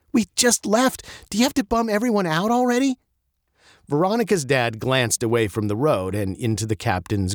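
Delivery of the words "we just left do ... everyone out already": high, quick teasing complaint; exasperated teen. Acted. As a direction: needle dad for being gloomy. Maddie teasing her dad.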